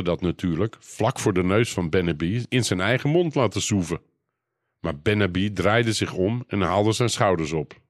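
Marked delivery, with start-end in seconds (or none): none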